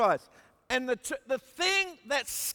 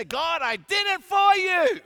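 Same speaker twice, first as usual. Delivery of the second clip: loud, higher boast to God; proud shout; 7 semitones higher, 6 dB louder than usual. Retold or imitated. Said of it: "I stamp in and say".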